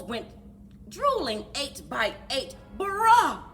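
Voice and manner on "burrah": plain reading voice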